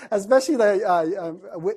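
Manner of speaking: ordinary talk, nothing marked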